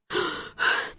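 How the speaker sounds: soft unvoiced panting; winded boy catching his breath; breathless gasps. A narrator's reading. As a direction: gasp for air after running. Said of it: "trying to get his breath".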